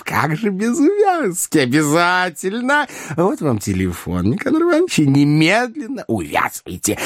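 high, eager character voice with stretched words; exclaiming. A narrator's reading. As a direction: urge eagerly in a high put-on voice. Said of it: "вскричал Коровьев". (восклицая, высоким суетливым голосом)

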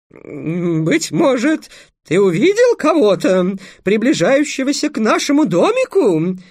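high loud falsetto princess; boy playing a girl. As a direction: overact the lady in falsetto. Pesya prompting in princess voice. (мальчик пищит фальцетом за княгиню)

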